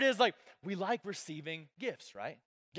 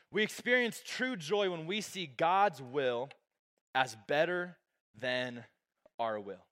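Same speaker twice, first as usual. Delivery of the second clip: slow emphasis; 4 semitones lower, slower than usual. Shown as a demonstration. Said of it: "Number two is just".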